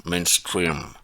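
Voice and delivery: low English-accented "mainstream". Shown as a demonstration. Showing viewers his accent on a marketing word, English accent. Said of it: "vous avez vu mon accent".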